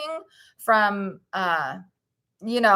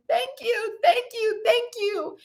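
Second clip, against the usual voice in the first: tiny, much higher thank-you voice; grateful cells; 11 semitones higher than usual. Acted. Host voices the viewers' cells thanking them, much higher. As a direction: voice the happy cells, squeaky and sweet. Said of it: "They're saying".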